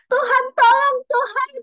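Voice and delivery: very high, loud, frantic shriek for help; panicked scream to God. Retold or imitated. On the roller coaster, terrified, screaming loudly for God's help. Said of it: "Jadi tapi aku ngomongnya gitu".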